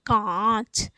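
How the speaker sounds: कांच with very long nasal आ, separate च; nasalised vowel demo. Shown as a demonstration. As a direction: stretch कांच through mouth and nose. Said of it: "तो ये उच्चारित होगा".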